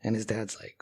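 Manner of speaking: normal talking voice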